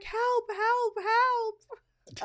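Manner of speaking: high, loud mock panic; panicked cry for help. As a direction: yelp for help as if a warthog is charging. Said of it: "He's like".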